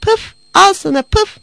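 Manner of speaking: high, loud outrage with spitting puffs; furious cat. A narrator's reading. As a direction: lash out like an insulted cat. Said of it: "Öfkeyle ... diye bağırdı".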